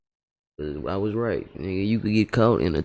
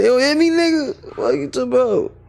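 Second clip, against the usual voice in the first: very high-pitched indignant rant; mock outraged brother; 13 semitones higher than usual. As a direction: scold the missing twin in exaggerated alarm. Plays the brother scolding his twin.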